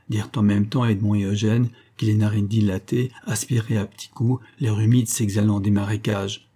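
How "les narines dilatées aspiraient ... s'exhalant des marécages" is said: ordinary delivery, nothing marked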